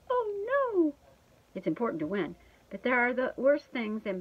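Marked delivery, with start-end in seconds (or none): 0.1-0.9 high, drawn-out mock alarm; dismayed cry. A narrator's reading. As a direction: wail it as if cornered in a game. Dog cornered in chase.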